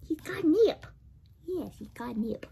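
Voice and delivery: hushed unvoiced whisper; whispered guess that the toy has catnip. Real, not acted. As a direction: whisper the secret about the nip.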